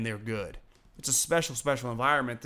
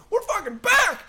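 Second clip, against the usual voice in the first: imitating a wild fan cheer, much higher and louder; 9 dB louder than usual. Podcaster imitating jubilant Raiders fans, mock triumphant yell. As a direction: yell it like a fan celebrating a comeback.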